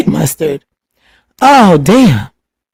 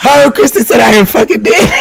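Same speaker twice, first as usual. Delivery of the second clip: high, laughing outcry; laughing shout; 4 semitones higher than usual. Real, not acted. Reading Kristen's comment to her live viewers, half shouting through laughter. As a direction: crack up reading the comment aloud.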